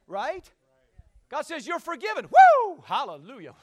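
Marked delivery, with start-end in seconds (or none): 2.3-2.8 high, loud "woo" of praise; exultant whoop. Real, not acted. Preacher exults at forgiveness.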